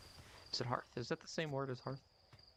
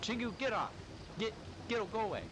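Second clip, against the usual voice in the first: shooing shout; 8 semitones higher than usual. Real, not acted. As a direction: yell at the pest to get off. Shooing an animal off.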